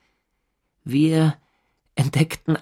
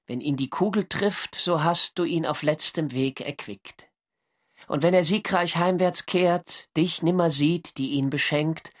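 Gedichtvortrag; 3 semitones higher, 3 dB quieter than usual. A narrator's reading. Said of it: "ein Verschen zu".

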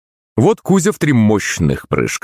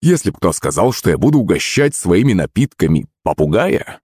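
plain reading voice

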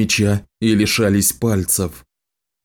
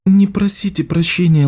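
Samantha's voice; 6 semitones higher than usual. Acted. Voiced young woman character.

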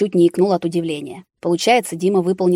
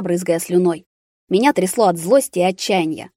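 ordinary delivery, nothing marked

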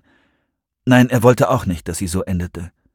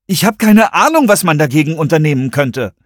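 lauter, höher gerufen, ratlos; rufend; 6 semitones higher, 6 dB louder than usual. A narrator's reading. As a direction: ruf es laut und hilflos heraus. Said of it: "rief Christopher". (lauter, ratloser Ruf)

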